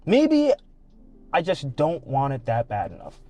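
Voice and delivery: usual speaking voice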